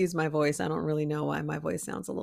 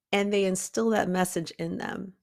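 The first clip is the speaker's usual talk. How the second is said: ordinary delivery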